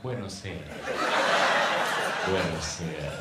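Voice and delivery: mock Italian Buonasera; Italian greeting. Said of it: "Grab your nuts and be like".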